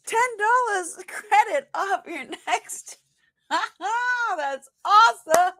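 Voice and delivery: high, laughing, excited speech; laughing with delight. Real, not acted. Host celebrating the winner, high and laughing. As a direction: laugh through the good news.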